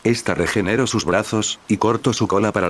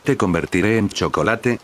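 plain reading voice

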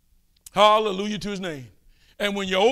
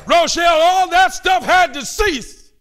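fervent preaching; 6 semitones higher, 5 dB louder than usual. Real, not acted. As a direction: drag it out high and loud. Preaching climax.

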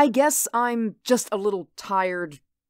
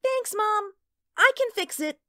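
a lightly voiced eager little girl, higher pitched; a small girl earnestly explaining her game; child's voice; 11 semitones higher than usual. Mink answers her mother.

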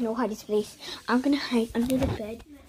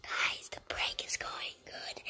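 whispering; 10 dB quieter, slower than usual. Real, not acted. Secret prank commentary.

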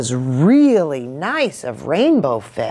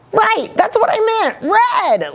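quick, high cover-up; flustered, high-pitched backtracking; defensive. A narrator's reading. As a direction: hurriedly cover a slip. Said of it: "Little Blue's quite defensive".

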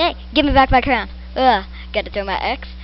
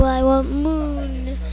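slow drawl; 7 dB quieter, much slower than usual. Pretend. Drawn out moo voice.

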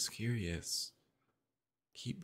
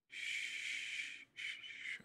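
soft unvoiced shushing; hushing; 8 dB quieter, much slower than usual. Partner hushing a panicking loved one, soft shushing. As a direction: shush them gently to calm.

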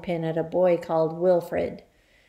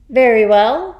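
loud, higher headmistress boom; 4 semitones higher, 13 dB louder than usual. Acted. Trunchbull opens the times table test, booming. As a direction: boom it out over the class. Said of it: "boomed the Trunchbull".

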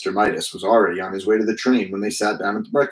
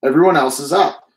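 calling out; 5 semitones higher, 5 dB louder than usual. A narrator's reading. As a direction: still calling louder and higher through the door. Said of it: "Missus Midas called".

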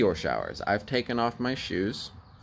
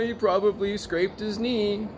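much higher, dismissive mimic of a tipsy laughing mom; mocking mom; 12 semitones higher than usual. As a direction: imitate mom brushing it off, high and mocking. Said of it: "was laughing, having drinks, going".

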